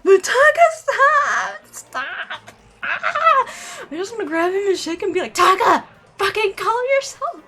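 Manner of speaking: high, loud frantic shouting; freaking out over the game; panicked yell. Real, not acted. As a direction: shriek at the screen in panic. Frantic yelling at screen.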